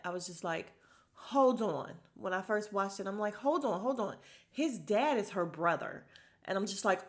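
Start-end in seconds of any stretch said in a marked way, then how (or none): 1.1-1.9 loud, higher-pitched retold shock; alarmed double-take; startled reaction. Retells own shocked first reaction. 3.3-6.1 loud, raised-pitch retold shock; stunned double-take, louder; shocked realization. Retells own shock at the reveal.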